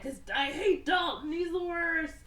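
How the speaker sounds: high, whiny-villain outburst; mock tantrum. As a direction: blurt it out in hammed-up hatred.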